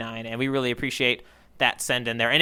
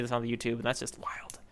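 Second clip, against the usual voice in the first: unvoiced whispered aside; 5 semitones lower, 10 dB quieter, faster than usual. Real, not acted. Host whispering an aside to podcast listeners, hushed. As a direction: drop to a hushed whisper of amazement.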